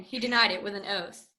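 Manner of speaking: usual speaking voice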